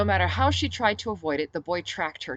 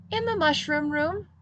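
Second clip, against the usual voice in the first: put-on much higher child's answer; cheerful toddler reply; little girl; 12 semitones higher, 5 dB louder than usual.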